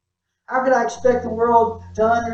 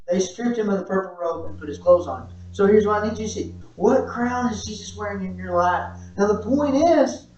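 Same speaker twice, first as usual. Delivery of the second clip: ordinary delivery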